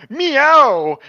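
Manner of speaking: loud, high, pitiful meow; piteous cat cry. Acted. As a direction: wail like a frightened cat. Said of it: "it cried piteously".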